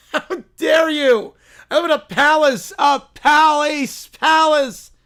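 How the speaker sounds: high, loud, drawn-out princess outrage; outraged Little Princess. Acted. Princess defending her palace, outraged. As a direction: correct them loudly, haughty and indignant. Princess insulted about toilet castle.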